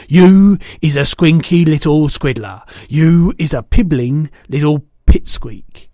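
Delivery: a big brute bragging loudly; loud giant. A narrator's reading. Bloodbottler mocking the BFG, loud and boastful.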